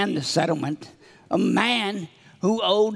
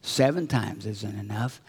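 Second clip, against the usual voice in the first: low, soft voice of God; God answering; 9 semitones lower, 5 dB quieter than usual. Acted. God answers the child's question, low calm correction. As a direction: correct them in a lowered, quieter voice. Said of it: "And God says".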